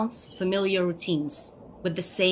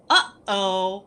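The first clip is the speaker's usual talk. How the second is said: playful uh-oh; 5 dB louder than usual. Shown as a demonstration. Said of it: "and each time you go".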